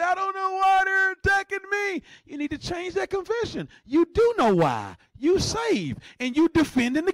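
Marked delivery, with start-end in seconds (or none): 0.0-2.0 high-pitched mock wail; mock crying. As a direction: wail it like someone feeling sorry for themselves. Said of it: "sit there and cry and say".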